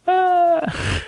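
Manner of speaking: hammed-up gasp and very high "ah"; mock gasp. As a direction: gasp in exaggerated, squeaky horror. Mock shock at repeated betrayal.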